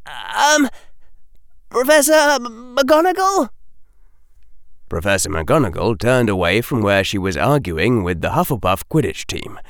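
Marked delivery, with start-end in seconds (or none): 0.0-3.5 high, halting and wavering; strained high call that trips on the name; raised, struggling voice. A narrator's reading. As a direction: push the words out as though speaking is hard. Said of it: "raised his voice further, as though it were hard".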